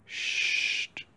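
soft whispered hush; shushing. Acted. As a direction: hush them gently to sleep. Hushing children to sleep.

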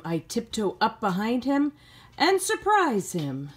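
slow, gentle storybook reading; reading to children. A narrator's reading. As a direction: read it like a bedtime story to kids. Teacher reads picture book aloud.

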